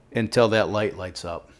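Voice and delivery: ordinary delivery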